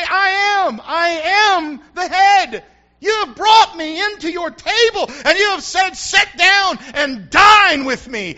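a loud, triumphant declaration of faith; triumphant shout. Acted. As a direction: proclaim it at full force. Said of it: "you need to say".

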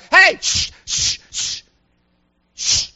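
loud high call, then shushes; telling to hush. Retold or imitated. Disciples hushing the shouting blind beggar, urgent shushing. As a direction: grab attention, then shush repeatedly. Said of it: "tell him to hush".

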